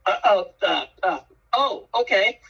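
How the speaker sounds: mock-flustered realization, climbing up; flustered surprise. [name] realizes it's Charlie Farley.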